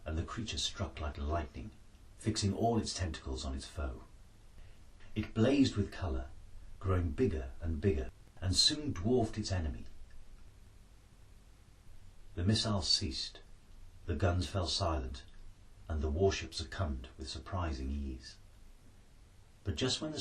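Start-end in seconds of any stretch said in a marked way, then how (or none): none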